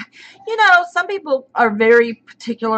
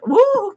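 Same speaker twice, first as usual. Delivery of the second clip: excited cheer; 12 semitones higher than usual. Real, not acted. Welcoming a viewer to the stream.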